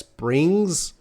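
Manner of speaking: "brings" said high with a clear final S; stress on final S. Shown as a demonstration. Teacher correcting a student's grammar, emphatic "brings". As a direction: punch "brings", sounding the S at the end. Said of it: "You need the S".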